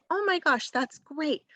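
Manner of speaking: high-pitched, delighted teacher praise; acted encouragement for a young writer; gentle encouragement. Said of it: "you wanna really encourage all the great".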